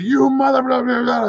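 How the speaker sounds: high, mock cursing voice; a hint of an angry pilot sputtering; mock angry rant. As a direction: pilot ranting with blahs in place of curses.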